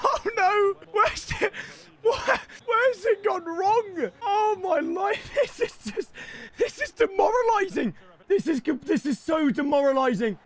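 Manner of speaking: high-pitched despairing wail; near tears. Real, not acted. Gamer despairing at a demoralizing game, near tears. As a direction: whine as if about to cry. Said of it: "I'm gonna cry".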